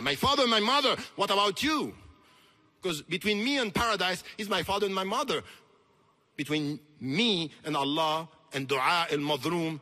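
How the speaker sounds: loud, emphatic challenge to the listeners; impassioned preaching. Real, not acted. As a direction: preach it forcefully, leaning on the key words. Impassioned preaching after verse.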